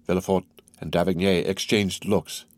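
plain reading voice

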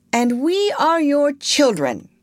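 put-on high-pitched childlike cry; excited cry. A narrator's reading. Twins presenting themselves to Wendy, excited high cry. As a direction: call it out high and eager. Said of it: "cried the twins".

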